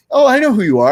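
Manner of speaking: high, loud delighted gasp of recognition; light up like a fan meeting a hero; excited recognition. Retold or imitated. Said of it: "So the two reactions are".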